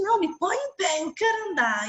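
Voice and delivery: won't take no, stand me up; insistent boy. Retold or imitated. Boy insists to his mother he stand, high and insistent.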